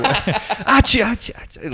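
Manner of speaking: sneezes growing fainter; sneeze, then fade away; fading sneezes. Retold or imitated. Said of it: "fainter, like, as he walked away".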